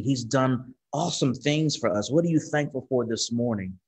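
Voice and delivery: normal talking voice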